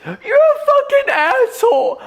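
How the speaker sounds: the stranger reacting to therapy advice with a squeal; mock outraged retort. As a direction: shriek the insult like you're offended. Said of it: "I'd be like".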